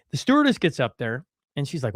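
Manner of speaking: ordinary talk, nothing marked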